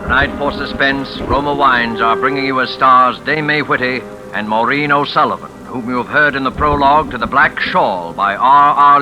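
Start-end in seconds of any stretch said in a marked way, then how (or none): none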